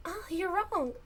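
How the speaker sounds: put-on high-pitched girl's voice, cracking; high girly voice. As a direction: squeak it out high like a young girl. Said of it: "Did you get the right, the right tone".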